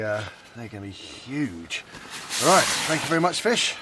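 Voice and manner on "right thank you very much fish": louder, higher, chirpy thank-you; cheery thanks. Real, not acted. Angler thanking the carp before its release, loud and cheerful. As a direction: beam a loud thanks at the fish.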